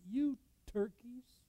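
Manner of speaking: high, slow mock insult "You turkeys"; a hint of a king sneering at his people; scornful king. Preacher voicing the king insulting the people, drawn-out mock scorn. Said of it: "he would have said in our vernacular".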